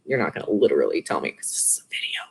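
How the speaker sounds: fast hushed whisper; whispered aside. Real, not acted. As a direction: drop to a quick whisper as a side joke. Whispered joke aside to viewers.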